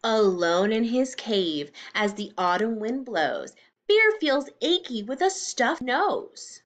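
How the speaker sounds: slow, sing-song storybook reading; reading to children. A narrator's reading. Teacher reading a picture book to the class. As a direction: read it slowly to little ones.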